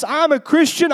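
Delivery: high-pitched, exuberant proclamation; triumphant declaration. Acted. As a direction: declare your faith like a champion. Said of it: "when we walk into church".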